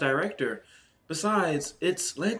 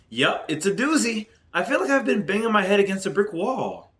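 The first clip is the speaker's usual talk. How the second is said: woman's voice; 5 semitones higher, 4 dB louder than usual. Acted. Playing Hu Tao.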